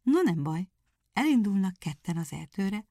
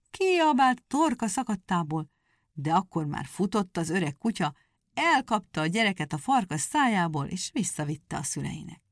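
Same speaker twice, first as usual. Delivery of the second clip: plain reading voice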